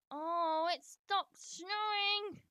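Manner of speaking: loud let-down wail; dismayed surprise. Real, not acted. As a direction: cry out as the fun ends. Snow stops in game.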